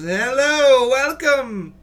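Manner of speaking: high, loud, cheery elf welcome; tall elf greeting. Acted. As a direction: burst in with a bright high greeting. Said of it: "brushes the door open and goes".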